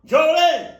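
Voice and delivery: loud, high, frantic calling of her name; frantic shouting. Retold or imitated. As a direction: yell across the room to get her attention. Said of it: "I would've been like".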